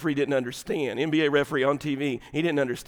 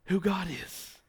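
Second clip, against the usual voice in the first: hushed; 2 semitones higher, 6 dB quieter, slower than usual. Acted. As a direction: whisper "who God is" as a prayer.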